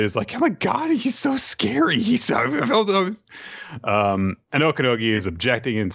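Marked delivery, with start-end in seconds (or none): 0.3-3.2 high, squeaky mock freak-out; comically rattled, pitched way up; panicked venting. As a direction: vent in a hammed-up high voice. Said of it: "just vents a bit".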